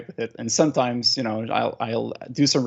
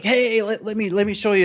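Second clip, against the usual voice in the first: high, eager show-off call to his wife; bursting to show the new trick; excited; 9 semitones higher than usual. Retold or imitated. Husband calling his wife over to see the gadget, excited. Showing off new smart light.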